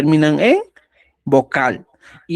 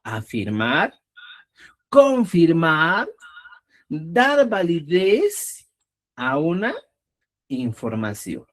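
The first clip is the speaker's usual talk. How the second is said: slow emphasis; 2 semitones lower, slower than usual. Shown as a demonstration.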